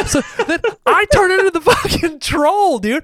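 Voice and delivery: high, laughing near-shout. Real, not acted. Confessing to friend about turning into a troll, laughing loudly. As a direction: burst out laughing through the words.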